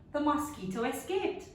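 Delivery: ordinary delivery, nothing marked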